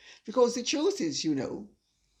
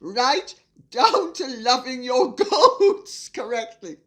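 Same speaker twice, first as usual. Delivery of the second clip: loud reading broken by laughter; cracking up; 3 semitones higher, 7 dB louder than usual. Real, not acted. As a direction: burst out laughing mid-sentence. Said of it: "I'll read that again".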